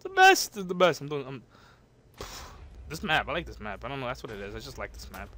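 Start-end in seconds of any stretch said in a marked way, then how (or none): none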